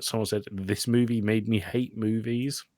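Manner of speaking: ordinary delivery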